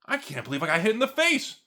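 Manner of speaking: high, squealing outrage over a punch; whiny mock victim. To the viewers, mocking a button-pusher in a high whine. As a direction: ham up a shocked snowflake. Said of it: "And you go".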